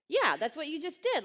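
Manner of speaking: emphatic, high-pitched yes; enthusiastic encouragement. Retold or imitated. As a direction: jump in with loud approval.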